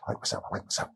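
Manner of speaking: whispered under the breath; whispered self-talk. Shown as a demonstration. Speaker showing self-talk through the day, whispered. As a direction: whisper it to yourself. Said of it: "You go through the day".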